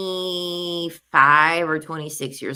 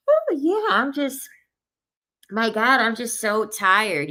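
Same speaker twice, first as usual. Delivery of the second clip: mock tired sigh; 4 semitones higher than usual. Mocking woman dodging bad breath.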